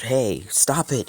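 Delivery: loud, high, drawn-out yell; indignant shout across the field. Acted. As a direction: call out to make them stop. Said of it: "they keep yelling out".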